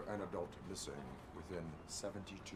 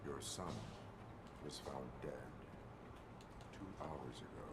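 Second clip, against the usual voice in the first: low, soft, halting delivery; grave bad news; 6 semitones lower, 7 dB quieter than usual. Officer tells a parent the son is dead, hushed and grave. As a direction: break it gently, with pauses.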